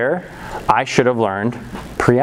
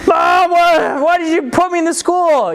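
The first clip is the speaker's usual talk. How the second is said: high, whiny complaint; hammed-up kid wailing at a parent; mock angry; 3 dB louder than usual. Kid home from school blames his mom, whining angrily. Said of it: "I'm kinda angry about it".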